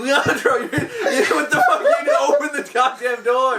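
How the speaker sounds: high, shouted scolding through laughter; exasperated mock yell. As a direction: retell losing it at a clueless scene partner. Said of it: "I'm like".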